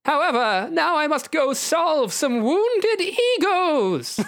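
high, drawn-out character voice; grand, slow and high-pitched proclamation; theatrical hero. Acted. Hero announces he must go, theatrically. Hero's line in gamebook, voiced.

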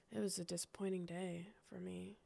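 hushed, mock-sad whisper. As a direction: breathe it out like a small tragedy. Mock-sad about a disappointing day.

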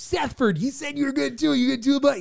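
high, chummy youth pastor. Retold or imitated. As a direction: repeat the goofy pastor coaxing, high. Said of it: "he called me by my youth group name".

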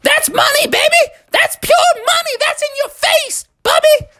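very high cartoon granny taunt; high-pitched trash-talking old lady; gloating grandma. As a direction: crow in their face as a victorious grandma. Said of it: "dancing around and saying".

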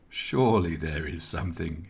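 understated tearful plea, falling away; weeping plea. A narrator's reading. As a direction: plead as if through tears, lightly acted. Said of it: "he wept".

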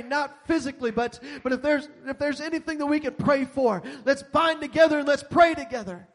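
loud, driving preacher voice; preaching at full force. Real, not acted. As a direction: preach it out to the whole room. Preaching to the congregation.

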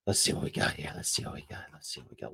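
very low, barely voiced mumble fading away; muttering to self. Real, not acted. Host checking the last bit, absent-minded near-whisper. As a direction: mumble under your breath while looking something up.

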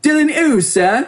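high cockney driver; cheeky high London accent. Acted. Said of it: "which I'll give a little more of a cockney".

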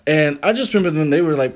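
usual speaking voice

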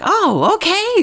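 put-on high, slow little-kid "Oh, okay"; child voice. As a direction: play the kid who just got it.

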